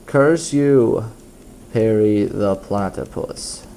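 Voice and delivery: ordinary delivery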